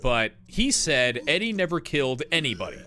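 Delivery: ordinary talk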